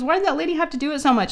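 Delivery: high, fast, whiny complaint; imitating tearful child. Daughter complaining about the nurse's swabbing, mock tearful. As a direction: mimic a kid protesting through tears. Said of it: "she was, like, in tears".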